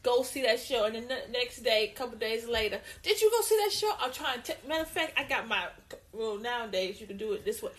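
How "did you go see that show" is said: high, loud, excited ask; bright, eager, loud question. Retold or imitated. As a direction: ask like you can't wait to hear. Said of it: "eager follow-up question".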